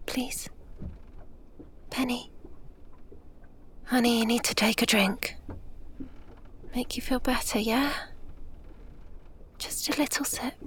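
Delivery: put-on soft, hushed coaxing to a sick child; soothing whisper. Mother coaxing Penny to drink water, tender whisper. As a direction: coax her gently to sip, barely voiced.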